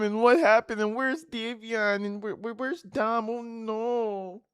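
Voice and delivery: high wailing imitation, loud then fading; hammed-up bewildered lament; mock distraught. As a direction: mockingly plead for answers in a high voice. Mockingly imitates the woman's questions.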